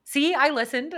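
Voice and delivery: imitating Aaron. Mocking her husband's protest, lightly defensive. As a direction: play the husband insisting he listened. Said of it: "Aaron says to me".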